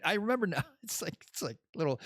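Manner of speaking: normal talking voice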